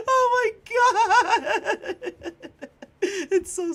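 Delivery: slow, high, breathless laugh. Real, not acted. Helpless laughter at the chat joke. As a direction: gasp it out mid-laugh.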